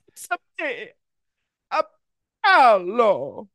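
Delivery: high, hammed-up Italian accent. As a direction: overdo the Italian like Jared Leto. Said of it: "doing Italian accents".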